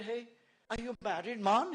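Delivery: high, loud West Indian question; West Indian accent. Retold or imitated. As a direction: imitate a nosy West Indian youngster. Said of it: "this is how they speak".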